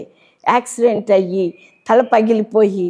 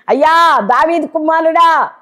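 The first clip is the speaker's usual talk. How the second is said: a mother crying out higher and louder in distress; anguished cry; 7 semitones higher, 5 dB louder than usual. Retold or imitated. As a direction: call out to the Lord in pain. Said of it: "ఆమె అరుస్తుంది, బాధ వేదనతో అరుస్తుంది". (బాధతో గట్టిగా అరవడం)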